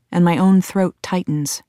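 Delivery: plain reading voice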